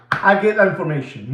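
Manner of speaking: ordinary talk, nothing marked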